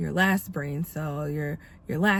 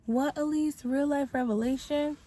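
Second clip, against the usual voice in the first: high-pitched mock questioning, much higher than usual; imagined listener's question; 9 semitones higher than usual. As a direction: play the listener asking back in a high voice. Voices the audience questioning her.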